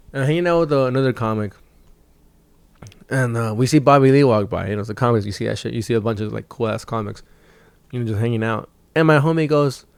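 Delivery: usual speaking voice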